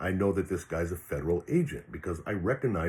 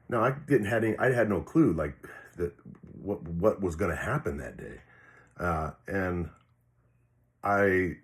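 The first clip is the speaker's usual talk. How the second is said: normal talking voice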